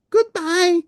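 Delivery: very high call; waving after a friend; Elmo calling goodbye. Acted. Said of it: "called Elmo".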